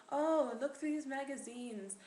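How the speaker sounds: lilting, hammed-up French accent; put-on French accent. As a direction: imitate grandma with an exaggerated French accent. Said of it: "I don't know why I'm doing this accent".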